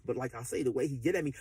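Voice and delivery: usual speaking voice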